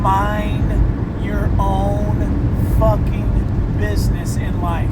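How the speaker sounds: stretched very slow, "fucking" weighted most; slow emphatic advice. Real, not acted. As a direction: drag every word out to make it stick. Closing advice, hammered home.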